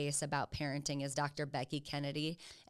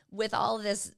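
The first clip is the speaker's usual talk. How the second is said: ordinary delivery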